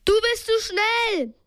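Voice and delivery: high-pitched urgent call. A narrator's reading. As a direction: call it across the water. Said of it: "Aber dann rief Justus".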